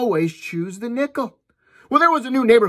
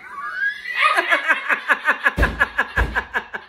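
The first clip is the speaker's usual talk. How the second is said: big laugh; 8 semitones higher than usual. Real, not acted. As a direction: crack up laughing hard. Laughing at own joke.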